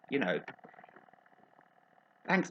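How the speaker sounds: normal talking voice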